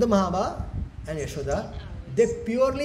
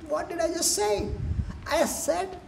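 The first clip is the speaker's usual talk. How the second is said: high, hurt whine; acted bewildered self-pity, pitched up; mock tearful complaint; 7 semitones higher than usual. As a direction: wail mildly like a rejected child. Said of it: "he was crying".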